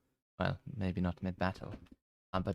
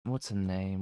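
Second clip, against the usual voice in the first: normal talking voice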